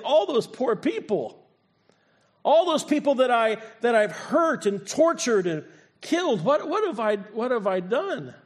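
high, loud, anguished and slowing; breaking with guilt over the people he hurt; grief-stricken. Retold or imitated. Saul grieving those he killed, anguished. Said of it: "he's thinking".

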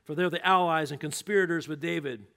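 loud, fast furious order; ordering kill. Acted. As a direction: command the guards. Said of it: "He said to all the men".

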